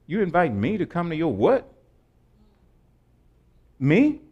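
acted disbelief, questions jumping upward at the end; pause, then a rising, incredulous question; mock incredulous. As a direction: play a rich snob taken aback by an invitation. Rich person acting out disbelief at invitation.

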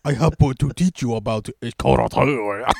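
loud, high fake Japanese accent; hammed-up Japanese-accented sensei; Master Splinter. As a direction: declaim it in a caricatured Japanese accent. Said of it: "an impression of his racist accent".

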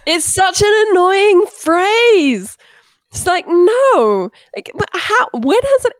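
slow, high drawn-out disgust; exasperated. Real, not acted. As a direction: stretch it out, fed up. Exasperated complaint about phrase.

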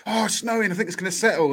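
high-pitched mock excitement; imitating excited kids. Dad imitating his kids seeing snow, high and excited. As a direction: pitch it way up like thrilled children. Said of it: "Kids got all excited".

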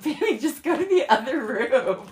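giggly retold plea; laughing. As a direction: laugh while begging him to leave. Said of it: "And I'm laughing so hard".

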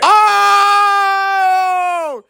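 sudden high whoop of excitement; excited shout. Real, not acted. Friend cheering at the shot, excited whoop. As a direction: yell out in delight as the shot lands.

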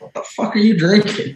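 high-pitched retold telling-off; mock dad outrage. Son imitating his hard-ass dad catching him with fruity beer, outraged. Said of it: "unloaded on his ass".

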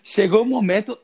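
strained announcement with a cracking voice. Real, not acted. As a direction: voice breaks mid-announcement. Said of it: "A voz falhou".

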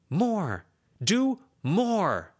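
high, loud demand. Acted. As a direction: shout it at her friend. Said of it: "Lucy raised her voice".

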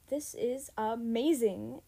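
loud, drawn-out cry of amazement; excited yell. A narrator's reading. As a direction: yell it slowly, stretching the words. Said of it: "Zoe yelled".

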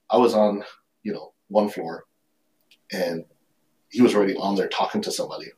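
ordinary delivery

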